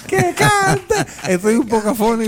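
high squeal of laughter into mock excuse; laugh, then play the singer; laughing mockery. Mocking the singer's hoarse excuse, high laughing.

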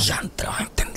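whispered, voiceless aside; hushed realization. Retold or imitated. Man voicing his reaction to sitting on their side, whispered. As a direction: whisper it as a dawning thought.

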